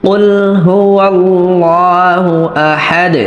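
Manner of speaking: slow melodic recitation; recited slowly. Shown as a demonstration. Teacher modelling the verse for students, slow chant. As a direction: draw out قل هو الله like tilawa.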